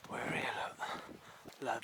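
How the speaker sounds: breathless whisper at the sight; awed whisper. Acted. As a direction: gasp then hushed whisper. Arriving at falls, awestruck.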